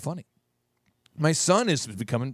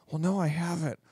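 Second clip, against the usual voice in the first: hushed mock whisper, much softer; 5 dB quieter than usual. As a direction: put on a weird, hushed little voice. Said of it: "stop talking like the whisper voice".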